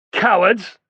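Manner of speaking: high, loud scornful yell; jeering shout. A narrator's reading. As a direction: hurl the insult. Soldiers jeer at them.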